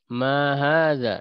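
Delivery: slow, drawn-out teacher model; slow model phrase. Shown as a demonstration. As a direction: read it slowly for them to repeat. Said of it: "bareng-bareng diikuti".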